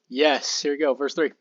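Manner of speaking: ordinary delivery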